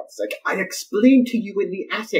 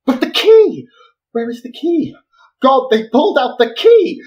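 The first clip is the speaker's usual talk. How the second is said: panicked about the key; 8 semitones higher, 7 dB louder than usual. Acted. Panicking over missing key.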